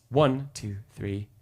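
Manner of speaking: "One" punched, "two, three" fading away; stress on one. Shown as a demonstration. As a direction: hit "One" and let "two, three" fall off. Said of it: "one is the strongest beat".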